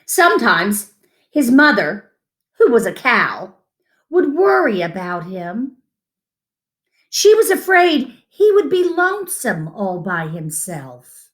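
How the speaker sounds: unhurried picture-book read-aloud; reading to children. Acted. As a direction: read it to little ones, savouring each line.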